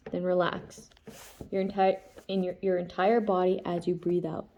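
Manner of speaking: calm, even instruction reading; reading aloud. A narrator's reading. Reads breathing instructions aloud.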